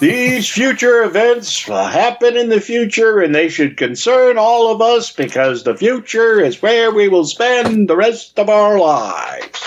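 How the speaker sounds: high, grand, portentous narrator voice; dramatic announcer impression. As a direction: intone it like an old sci-fi movie narrator. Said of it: "However that clip goes".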